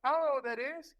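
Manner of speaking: stunned at being sent somewhere unknown; baffled. Retold or imitated. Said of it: "I was like".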